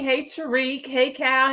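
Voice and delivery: ordinary talk, nothing marked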